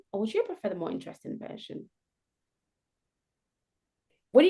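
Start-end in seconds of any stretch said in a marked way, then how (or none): 0.1-1.9 hushed, fast, gentle question; softly, quickly offering the interesting version. Shown as a demonstration. Coach demoing a soft offer to the interviewer, hushed. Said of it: "not my very happy tone, but also very soft tone".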